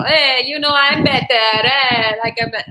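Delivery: loud, boastful mock Italian accent; hammed-up Italian show-off; Italian braggart. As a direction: brag bluntly in a put-on Italian accent, fading out. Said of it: "lots of Italians who have the conceit".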